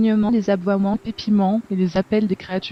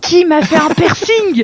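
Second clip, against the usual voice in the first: indignant; 7 semitones higher, 7 dB louder, slower than usual. Acted. Character's mock outrage.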